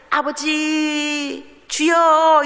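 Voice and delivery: high, slow drawn-out cry to the Father; pleading call. Retold or imitated. A believer calls out to the Father, drawn out. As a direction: cry out to God, stretching it.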